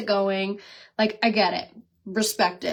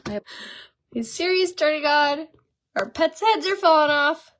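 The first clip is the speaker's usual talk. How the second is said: exasperated; 6 semitones higher than usual. Real, not acted. Exasperated at tripod falling.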